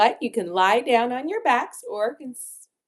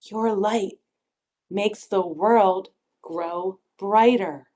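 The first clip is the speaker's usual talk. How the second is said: ordinary delivery, nothing marked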